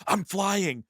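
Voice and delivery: imitating a witch raving in restraints; freaked-out witch. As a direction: escalate into a loud hammed-up wail. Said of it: "she's freaking out, having a total freak out".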